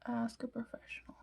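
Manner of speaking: soft breathy whisper; whispered aside. Real, not acted. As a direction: drop to a whisper for the punchline. Joking aside to viewers.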